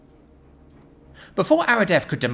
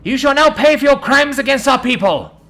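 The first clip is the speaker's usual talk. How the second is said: high, loud righteous fury; furious accusation; 12 dB louder than usual. Acted. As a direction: condemn him with full force. Warrior accuses the giant.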